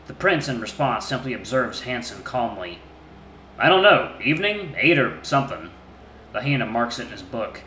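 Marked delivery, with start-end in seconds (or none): 3.6-5.7 loud, rough grumbling answers; a surly wolf under questioning; gruff wolf voice. Acted.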